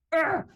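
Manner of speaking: sudden squeamish groan; recoiling "ugh". Retold or imitated. Retold recoil at hands on belly.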